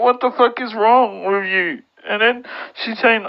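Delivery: normal talking voice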